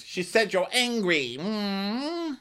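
high tattling voice with a hum; mock Karen. Karen tattling to Wendy, mock prim. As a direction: pass on gossip in exaggerated primness.

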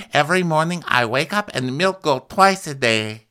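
put-on high Russian-accented impersonation; mock Russian accent. Russian castmate talking of her farm chores, high mock Russian accent.

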